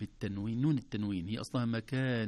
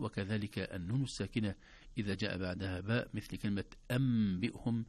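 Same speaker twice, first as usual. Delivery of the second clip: normal talking voice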